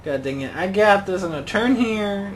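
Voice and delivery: ordinary talk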